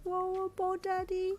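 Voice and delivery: put-on high little girl voice, drowsy; a sleepy child asking for her toy; sleepy toddler. A narrator's reading. Toddler asking her father for her ball, high and sleepy. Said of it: "Ko asked sleepily".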